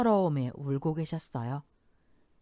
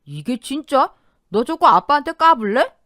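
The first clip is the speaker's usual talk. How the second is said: higher, much louder angry bellow; sudden yell; 8 semitones higher, 11 dB louder than usual. A narrator's reading. Father suddenly yelling at his daughter. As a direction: explode at her in rage. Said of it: "버럭 소리를 질렀죠".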